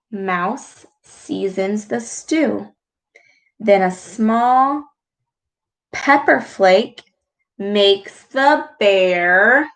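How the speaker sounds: gentle storyteller voice; read-aloud storytelling. A narrator's reading. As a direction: read it like a bedtime story to kids. Reads picture book aloud.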